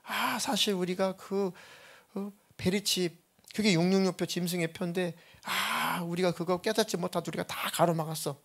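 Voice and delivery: demon confessing. Retold or imitated. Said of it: "귀신이 막 얘기하는 겁니다".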